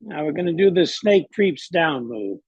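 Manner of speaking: normal talking voice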